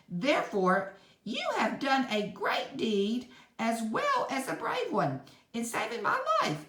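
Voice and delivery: high squeaky queenly thanks; a little queen praising her rescuer; mouse queen. A narrator's reading. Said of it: "added the queen".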